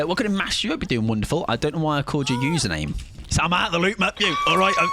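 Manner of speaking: ordinary talk, nothing marked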